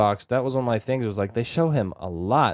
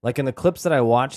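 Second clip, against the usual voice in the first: usual speaking voice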